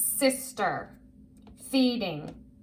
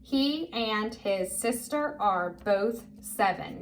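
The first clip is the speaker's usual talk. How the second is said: slow, word by word; careful, spaced-out read-along voice; model reading; slower than usual. Shown as a demonstration. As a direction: read it out slowly for a learner to follow. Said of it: "Say it with me".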